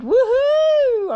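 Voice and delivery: high, loud joyful whoop; excited whoop. Real, not acted. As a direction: let out a delighted cheer. Cheering in excitement.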